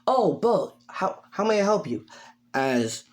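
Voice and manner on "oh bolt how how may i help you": high-pitched helpful character; high, obliging phone manner; Nezu. Acted. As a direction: greet the caller in a high voice. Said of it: "Nezu says".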